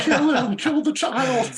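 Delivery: high, loud shrieking demand; banshee scream. Retold or imitated. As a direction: screech it like a banshee. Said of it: "He's just screaming like a banshee".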